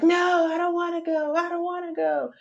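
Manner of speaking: put-on high-pitched toddler tantrum whine; whining child. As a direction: whine it like a kid refusing to leave. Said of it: "like a child, just whining".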